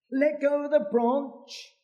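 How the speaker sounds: high, disbelieving echo of the order; incredulous repeat. Acted. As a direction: echo it in horror.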